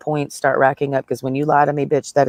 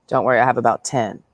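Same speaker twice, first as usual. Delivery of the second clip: ordinary delivery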